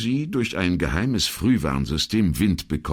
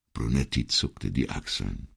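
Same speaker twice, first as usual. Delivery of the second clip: ordinary delivery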